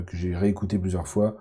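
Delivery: ordinary talk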